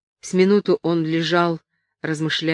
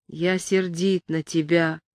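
usual speaking voice

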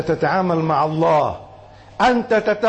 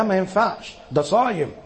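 normal talking voice

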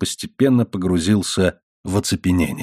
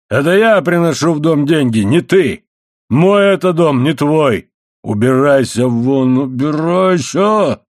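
raised, hard-driven angry shouting; furious tirade; 4 semitones higher, 3 dB louder than usual. A narrator's reading. Husband claims house and money, shouting furiously. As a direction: keep shouting, stretching the final orders out slowly.

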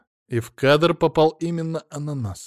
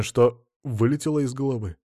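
ordinary delivery, nothing marked

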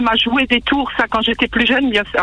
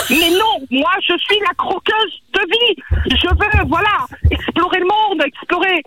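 high, bright woman's voice; 7 semitones higher than usual. Acted. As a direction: switch to a high female voice. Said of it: "une voix de femme".

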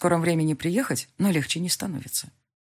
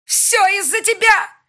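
higher, louder, slow shriek; 9 dB louder, slower than usual. A narrator's reading. Elvira shrieking blame at the heroine, furious. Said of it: "Срывается на визг".

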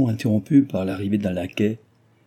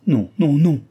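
higher and louder quick protesting denial; exclaiming; 6 semitones higher, 6 dB louder than usual. A narrator's reading. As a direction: cry out to deny it at once. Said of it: "s'écria Cécile".

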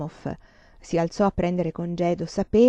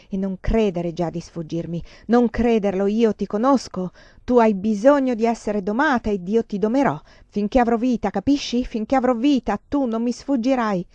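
usual speaking voice

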